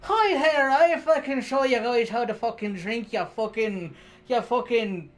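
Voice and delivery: high, loud Irish pub brag; Irish accent. Retold or imitated. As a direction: swagger it like a drunk Irishman. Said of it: "And then the fucking Irish comes out".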